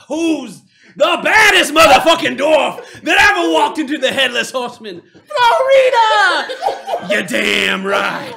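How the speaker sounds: slow, very loud, high rallying bellow; climactic dwarf boast. Acted. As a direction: roar it out to a cheering table.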